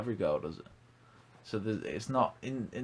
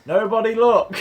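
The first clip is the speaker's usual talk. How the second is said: high, loud hammed-up squeal; mock show-off shout; 13 semitones higher, 15 dB louder than usual. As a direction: shriek it like a vain show-off. Mocking Ferrari driver.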